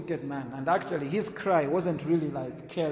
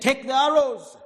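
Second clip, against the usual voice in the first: higher, louder order; prophet's command; 10 semitones higher, 7 dB louder than usual. Acted. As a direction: hand down the order with authority.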